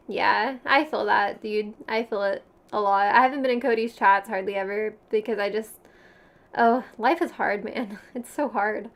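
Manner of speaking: ordinary delivery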